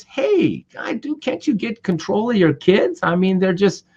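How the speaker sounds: high, exasperated complaint to a stranger; mock scolding a careless father; high-pitched irritated demand; annoyed confrontation. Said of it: "and he says".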